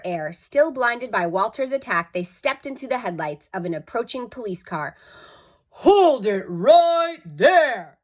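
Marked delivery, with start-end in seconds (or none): 5.7-8.0 loud, slow, higher order; commanding policeman. A narrator's reading. Policeman halting the burglars, loud and slow. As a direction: stop them in their tracks. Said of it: "said the policeman".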